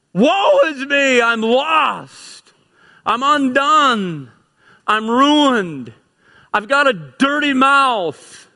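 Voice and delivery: high, loud, slow cry of despair; anguished cry. Acted. Preacher voicing Isaiah overwhelmed before God, anguished loud cry. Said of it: "and he goes".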